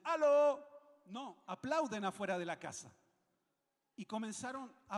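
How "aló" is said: loud, higher shout at a door; calling out. Retold or imitated. Chilean visitor hailing a house from outside, calling out. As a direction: call out as if hailing someone inside a house. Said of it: "que empecé a gritar".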